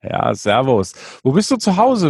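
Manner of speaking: usual speaking voice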